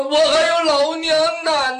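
high, sustained pleading call; pleading shout. A narrator's reading. Captive begs his chief for his mother's sake, high pleading shout. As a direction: cry out high, begging for your life. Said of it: "齐声这么一喊".

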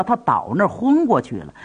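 plain reading voice

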